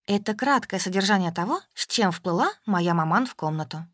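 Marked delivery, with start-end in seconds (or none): none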